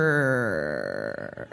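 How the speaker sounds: low, drawn-out reluctant sure; hesitant. Acted. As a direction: say it grudgingly after a long pause. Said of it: "said Gunder, after a long pause".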